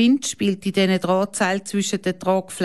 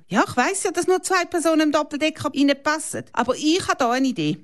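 put-on eager boy; 8 semitones higher, faster than usual. A narrator's reading. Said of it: "Kalle has an idea".

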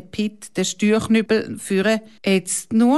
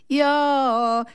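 higher gloating chant and cackle; wicked cackle; 6 semitones higher than usual. A narrator's reading. Kalle gloats at his victims with a mocking cackle. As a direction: gloat like a prankster. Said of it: "böses Kichern".

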